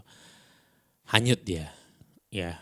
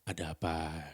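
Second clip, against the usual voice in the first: low, soft midnight radio murmur; hushed, calming radio-host question; late-night DJ voice; 4 semitones lower, 9 dB quieter, slower than usual. Shown as a demonstration. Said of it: "dengan intonasi D J tengah malam ini".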